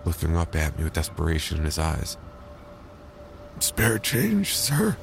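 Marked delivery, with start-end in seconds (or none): 3.6-5.0 high, pleading beggar voice. Acted. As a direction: beg for spare change. Homeless man begging narrator.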